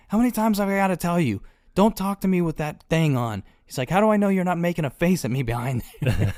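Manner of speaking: high, pestered boss's rant; hammed-up exasperated complaint; mock scolding. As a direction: imitate a fed-up superior telling someone off. President scolds masked henchman.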